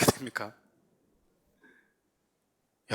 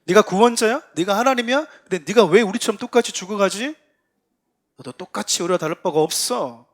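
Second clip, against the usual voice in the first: loud, higher-pitched sarcastic taunts; sneering, raised-voice ridicule of a supposed savior; jeering mockery; 5 semitones higher, 7 dB louder than usual. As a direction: throw each question out as a scornful challenge. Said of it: "라고 비아냥거렸다라는 거잖아요".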